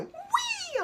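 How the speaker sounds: high squealing whee, like sliding down; a soul squeals as it zips back down; gleeful whee. Retold or imitated. Soul on a water slide, squealing a high whee. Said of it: "and they go".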